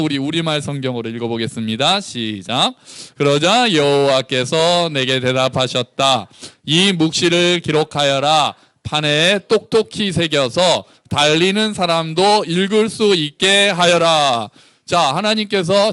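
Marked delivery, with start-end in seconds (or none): 3.2-14.5 slow, weighty Bible reading; scripture reading. Acted. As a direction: read God's answer slowly and clearly. (천천히 또박또박 성경 봉독)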